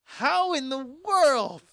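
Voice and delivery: high, loud, slow disbelief; exasperated outburst. Real, not acted. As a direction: shout it in sheer exasperation. Exasperated rant about door.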